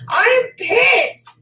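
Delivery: high, loud, raw screaming; furious crying scream. Real, not acted. Screaming at those who insulted her family, sobbing rage. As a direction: scream it through tears.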